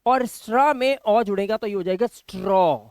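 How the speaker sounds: normal talking voice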